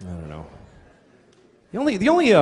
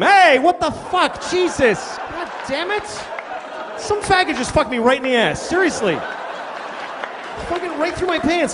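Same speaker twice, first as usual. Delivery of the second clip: outraged yelling; 9 semitones higher than usual. Pretend. Victim at the ATM yells in outrage.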